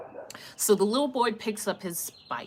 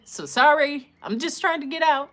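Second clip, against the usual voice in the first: louder, quicker pleading apology; frantic apology; 6 semitones higher, 5 dB louder than usual. Retold or imitated. Apologising to the neighbours, rushed and flustered. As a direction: plead fast to calm them down. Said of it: "I was like".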